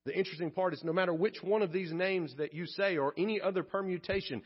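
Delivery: ordinary delivery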